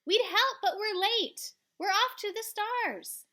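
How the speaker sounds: high, loud calls; calling out. Acted. Child calling down to sailors while flying off. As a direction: shout the apology upward.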